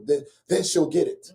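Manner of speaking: normal talking voice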